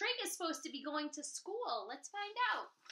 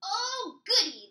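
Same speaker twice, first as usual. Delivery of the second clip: high, loud delighted troll; excited troll; 8 semitones higher, 11 dB louder than usual. Acted. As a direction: squeal with glee like a child. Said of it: "the troll said, jumping up and down".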